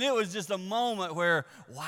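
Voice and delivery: ordinary talk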